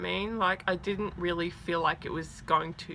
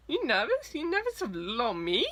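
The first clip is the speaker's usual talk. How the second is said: high-pitched, cutesy mock surprise; playful guard; 6 semitones higher than usual. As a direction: imitate someone teasing away a fan's nerves. Guard teasing away her nerves.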